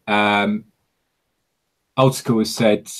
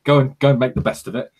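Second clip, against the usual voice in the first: dismissive official; 6 semitones higher, 3 dB louder, faster than usual. Retold or imitated. Imitating officials dismissing defectors.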